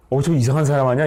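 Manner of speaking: mock alarm, a questioning double take; acted startled shock. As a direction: act out being startled by someone odd. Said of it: "이렇게 놀랬다는 거예요".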